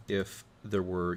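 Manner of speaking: ordinary talk, nothing marked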